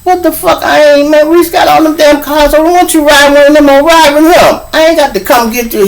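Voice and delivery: high, loud mock outrage; exasperated rant. Speaker snapping at Turk who wants a ride, exasperated rant. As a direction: complain loudly like he's asking too much. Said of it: "And I was like".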